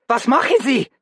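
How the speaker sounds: very high, loud German shriek; very high, loud panicked German shriek. A narrator's reading.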